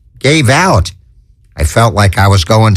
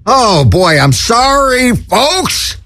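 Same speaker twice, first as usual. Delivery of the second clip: squeaky high voice, frantic and cracking up; frantic cartoon voice. The Chickster cracking up before the folks, squeaky high. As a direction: play a hysterical little cartoon bird.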